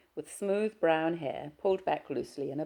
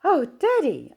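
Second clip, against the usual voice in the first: shocked gasp, pitched much higher and louder; 13 semitones higher, 10 dB louder, slower than usual. Acted. Daughter startled to hear her father's voice, gasping higher. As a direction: catch your breath in shock. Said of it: "I gasped".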